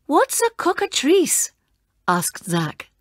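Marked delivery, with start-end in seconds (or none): 0.1-1.5 put-on high, questioning young voice; puzzled boy. Zach asks Bela what the monster is, high puzzled voice. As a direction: ask it, baffled.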